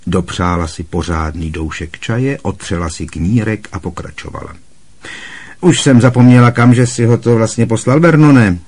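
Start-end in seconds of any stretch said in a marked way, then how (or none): 5.6-8.6 loud, high scornful rant; scornful Aunt Marge. A narrator's reading. Aunt Marge scorning Harry over tea, loud and contemptuous. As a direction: lecture him with contempt.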